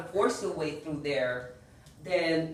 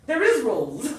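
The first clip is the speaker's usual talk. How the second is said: mock protest; 7 semitones higher, 8 dB louder than usual. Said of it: "It's like".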